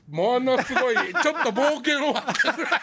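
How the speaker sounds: laughing through the phrase. Real, not acted. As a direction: giggle while saying it. Laughs, then restates plainly.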